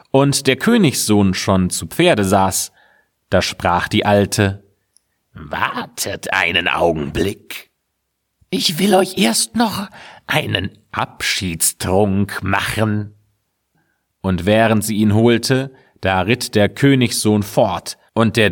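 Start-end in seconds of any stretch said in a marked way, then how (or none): 5.3-13.1 witch. A narrator's reading. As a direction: hold them back with false kindness. Witch offers a parting drink.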